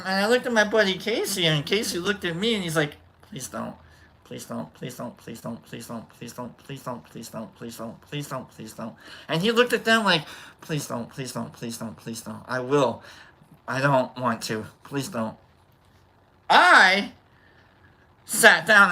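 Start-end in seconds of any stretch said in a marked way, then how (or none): 3.3-8.9 pleading whisper. Retold or imitated. Scared friend pleading under breath. 10.6-15.4 pleading whisper. Retold or imitated. Scared friend pleading under breath.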